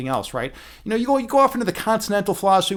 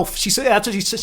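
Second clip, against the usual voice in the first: ordinary delivery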